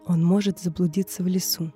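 ordinary delivery, nothing marked

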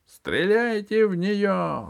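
high, slow shout at the guards; shouting order. A narrator's reading. Usurper shouting at his bodyguards, high yell. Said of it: "закричал он телохранителям".

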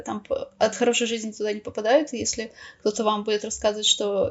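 ordinary talk, nothing marked

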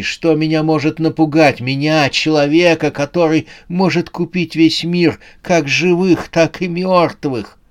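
high, indignant boasting; defiant rich old man. Acted. Rich man refuses to fear the monsters, defiant.

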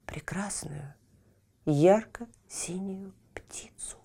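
hushed breathy whisper. A narrator's reading. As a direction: breathe it out in wonder. Narrator whispers the discovery.

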